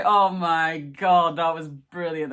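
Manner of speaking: slow, loud drawn-out "Oh my God" of delight; delighted gasp. Retold or imitated. Retelling her own reaction to the Doctor, loud delight. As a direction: savour it like you can't believe how good it was. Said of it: "So I was like".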